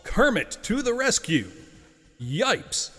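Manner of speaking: movie announcer voice. Acted. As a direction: sell it like a big movie trailer. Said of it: "I will use my best movie announcer voice here".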